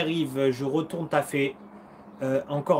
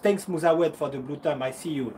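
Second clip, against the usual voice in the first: ordinary delivery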